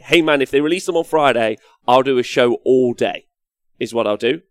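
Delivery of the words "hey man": ordinary talk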